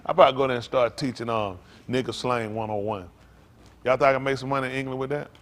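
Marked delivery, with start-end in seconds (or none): none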